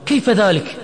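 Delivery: high, loud angry question; angry shout. A narrator's reading. Imad shouts at Majid over the news, angry. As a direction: shout it in outraged disbelief. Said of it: "صرخ عماد غاضبا".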